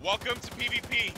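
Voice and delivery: high mock announcer call; mock game announcer greeting. Game catchphrase repeated.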